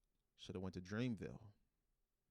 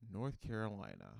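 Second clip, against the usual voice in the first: ordinary delivery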